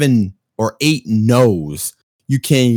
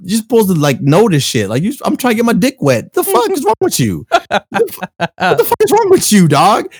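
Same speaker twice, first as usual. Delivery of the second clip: high mock outraged rant; exasperated yell; 10 semitones higher than usual. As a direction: rant at the dad in disbelief. Retold outburst at dad.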